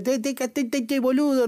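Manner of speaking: high, sing-song Argentine impression, te te te boludo; lightly exaggerated Argentine accent, high pitched; mock Argentine accent. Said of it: "con el acento argentino".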